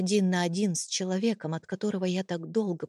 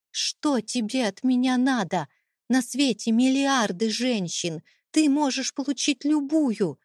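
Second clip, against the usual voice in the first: raised, high-pitched exasperation; desperate outburst; 5 semitones higher, 4 dB louder than usual. A narrator's reading. Wife begging to know what he wants, desperate. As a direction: plead in protest, voice lifted high.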